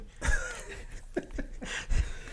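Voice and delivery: burst of laughter. Real, not acted. Laughs at own slip "By God.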